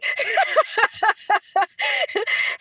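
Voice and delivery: high, hearty run of giggles; real laughter. Real, not acted. Streamer laughing after claiming Brooklyn, real laughter. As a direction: laugh at your own bragging.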